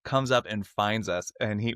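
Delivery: usual speaking voice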